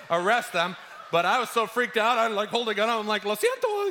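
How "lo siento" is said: "Lo siento" said in Spanish, pitched high and panicky; "Lo siento" blurted in Spanish. Retold or imitated. Scared boy apologising to the men in Spanish, high and flustered. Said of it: "I was talking in Spanish to them".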